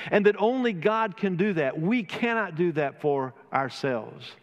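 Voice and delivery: rising, ringing pulpit voice with lifted pitch; fervent preaching. Acted. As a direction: preach it out to the congregation, building fervour. Impassioned sermon opening.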